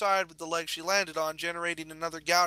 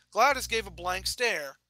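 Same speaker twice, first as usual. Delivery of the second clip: ordinary delivery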